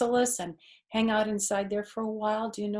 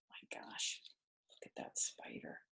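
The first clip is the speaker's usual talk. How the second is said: whispered mock fright at the spider; gasped whisper of mock horror; hushed awe; 15 dB quieter than usual. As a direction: whisper it to spook the child playfully. Spooky aside to child.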